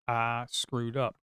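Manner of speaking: dragged out, each word slow; slow rueful repeat; slow, drawn-out regret. Real, not acted. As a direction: say it slowly, sinking in the mistake. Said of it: "repeated slowly".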